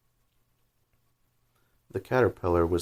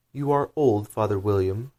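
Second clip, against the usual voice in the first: higher, louder recital opening; poem recital; 3 semitones higher, 5 dB louder than usual. Acted. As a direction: recite it like a schoolgirl performing.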